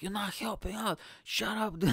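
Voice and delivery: high, mock-irritated retort; snapping. Comedian snaps at the girl who booed, high and irritated. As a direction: imitate snapping without raising the volume. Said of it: "I snap at her".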